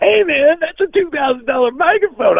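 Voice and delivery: high, hammed-up panicked crew guy; upset production guy. Production guy yelling at the singer over the pricey microphone, mock panic. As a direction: squawk it like a frantic producer. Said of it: "the production guy ran out".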